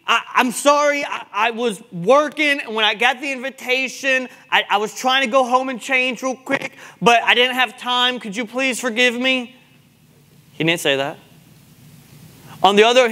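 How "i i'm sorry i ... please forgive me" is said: hammed-up pleading to a king; lightly acted begging for forgiveness; mock grand apology. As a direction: play a flustered, stammering excuse-maker. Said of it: "did not make any grand apology".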